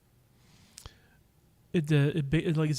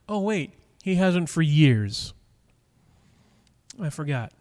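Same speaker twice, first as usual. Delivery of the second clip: sarcastic jab; 2 semitones higher, 4 dB louder, slower than usual. Acted. Sarcastic jab at viewer Stuart.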